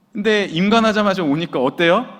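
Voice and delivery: normal talking voice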